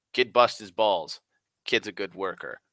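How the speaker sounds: plain reading voice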